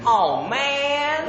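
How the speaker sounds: high, drawn-out American "maaan"; American accent. Shown as a demonstration. Brit trying American phrases, high American accent. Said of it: "my best American accent".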